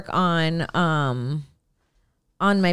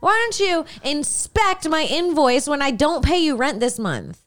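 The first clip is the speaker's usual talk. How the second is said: sassy; 6 semitones higher than usual. Retold or imitated. Telling off the smoke inspectors.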